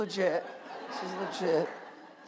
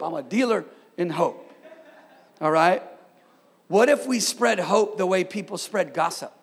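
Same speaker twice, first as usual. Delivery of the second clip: ordinary delivery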